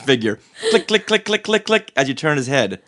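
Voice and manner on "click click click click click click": higher, evenly repeated mechanical clicks; imitated toy clicking. Showing how the figure's head turns, crisp clicking. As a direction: tick it out like a ratchet.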